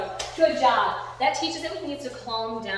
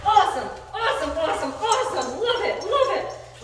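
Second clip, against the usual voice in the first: high, loud, gushing dog-praise; shower the dog with whooping, giddy praise; ecstatic praise; 9 semitones higher, 8 dB louder, slower than usual. Real, not acted. Celebrating dog's success in play.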